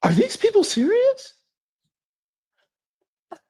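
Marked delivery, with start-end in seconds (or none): 0.0-1.3 incredulous. Real, not acted. Stunned reaction after replaying clip.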